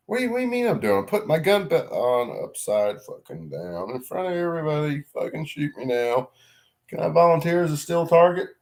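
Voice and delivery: mock exasperation. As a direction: act out your own mortified reply. Retelling own exasperated reply.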